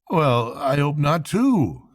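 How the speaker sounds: a touch of hearty cheer. A narrator's reading. Rat answering sickly Toad, slow and hearty. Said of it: "said the Rat heartily".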